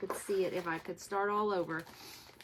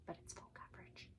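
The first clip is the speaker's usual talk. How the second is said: breathy aside, much softer; dismayed whisper; 19 dB quieter, faster than usual. Real, not acted. Stitcher admitting the kit is full coverage, whispered. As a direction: whisper the bad news to yourself.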